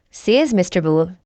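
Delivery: ordinary delivery, nothing marked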